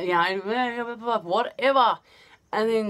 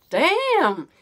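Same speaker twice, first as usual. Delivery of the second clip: rejected guys' exclamation; 10 semitones higher, 4 dB louder than usual. Retold or imitated. Guys rejected.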